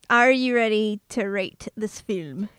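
normal talking voice

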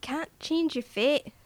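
convincing mock Scottish brogue; Scottish accent. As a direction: put on a confident Scottish accent. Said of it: "You just wanna go Scottish".

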